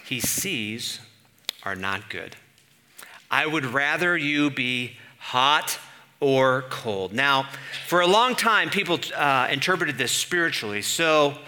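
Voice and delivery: usual speaking voice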